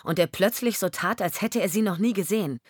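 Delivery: plain reading voice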